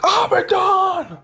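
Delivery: high, slow shouted challenge; heroic battle cry. Acted. Maui confronting Abaddon before the final fight, shouted. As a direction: cry it out like a hero facing his foe.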